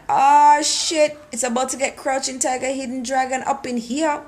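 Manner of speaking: high, loud burst that calms into a quick rush; excited outburst. Retold or imitated. Vlogger hyping herself up for a fight scene, high excited outburst. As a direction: shout the curse, then rattle the rest off fast.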